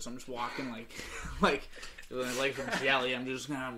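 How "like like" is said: ordinary talk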